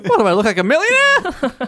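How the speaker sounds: rising to a high incredulous squeal; playful, hammed-up disbelief; mock indignation. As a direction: act outraged at the very idea, pitch climbing. Joke role, indignant bookmark scavenger.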